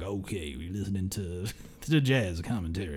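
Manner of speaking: low, soft, laid-back jazz-host voice; hushed, low-pitched jazz commentary impression. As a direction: croon it low and quiet, like a jazz radio host. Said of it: "like, [name] impression".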